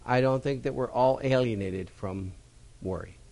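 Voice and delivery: usual speaking voice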